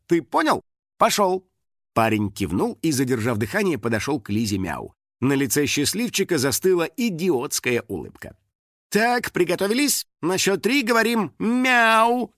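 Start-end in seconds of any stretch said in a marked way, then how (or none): 0.1-1.4 high, peremptory order; stern. A narrator's reading. Assistant sending fan to the star, stern. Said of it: "строго произнесла". 8.9-12.3 high, bright-pitched photographer cue; rally them for the shot, pitch held high; commanding. A narrator's reading. Photographer cueing fans for the photo, commanding. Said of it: "скомандовала фотограф".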